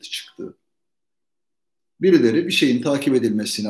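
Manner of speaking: normal talking voice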